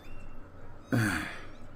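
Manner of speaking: long, soft acted sigh; weary sigh. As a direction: sigh softly on reading the letter. Shopkeeper opens the letter.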